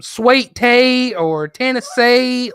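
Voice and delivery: imitated thick Southern drawl, high and slow. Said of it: "just the most southern drawl".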